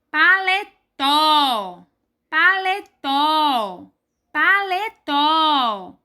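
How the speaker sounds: Paletó very slow, with a long strong final tó; Paletó syllable by syllable, last one held; stress on TÓ. Shown as a demonstration. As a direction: stretch Paletó and land hard on tó. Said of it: "a sílaba mais forte é o".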